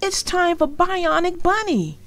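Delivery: mildly voiced boy refusing; boy's refusal. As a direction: push back like a big brother. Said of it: "said Arthur".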